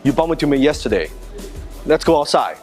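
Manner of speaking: ordinary delivery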